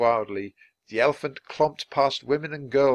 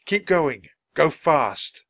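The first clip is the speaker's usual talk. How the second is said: raised, urgent urging; rising to shout; 4 semitones higher, 6 dB louder, slower than usual. A narrator's reading. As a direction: spur the animal on loudly. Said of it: "His voice rose to a shout".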